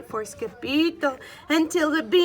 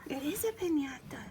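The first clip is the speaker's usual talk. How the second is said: whispered; 11 dB quieter than usual. Real, not acted. Whispered aside to child.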